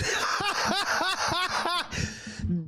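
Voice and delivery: real burst of high laughter; high-pitched laughter. Real, not acted. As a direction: crack up hard and high. Cracks up at the oven story.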